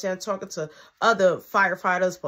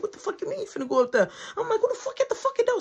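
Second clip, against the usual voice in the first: fast, much higher, laughing; frantic questioning; 13 semitones higher, faster than usual. Retold or imitated. Narrator demanding who's at the door, fast and frantic. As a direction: fire questions in a rush.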